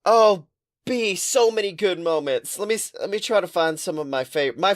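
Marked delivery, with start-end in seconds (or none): none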